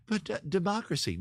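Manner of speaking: high-pitched mock heroine's plea; mock Padme. Woman in a weird love scene protesting about politics, mock plea. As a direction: parody her, pitched well up. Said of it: "and her going".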